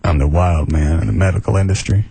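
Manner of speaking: deep, utterly calm doctor impression; Doctor Booth. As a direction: claim wildness in a low, relaxed voice. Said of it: "Quite calm".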